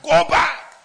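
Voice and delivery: "go back" flung out high and loud; shouted command. Acted. Preacher voicing heaven sending the unready back, high shout. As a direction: bark the order out.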